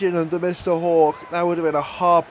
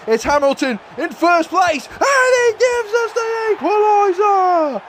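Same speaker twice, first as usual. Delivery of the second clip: ecstatic shout; 14 semitones higher than usual. Real, not acted. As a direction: scream the equaliser with joy. Late equaliser in the final.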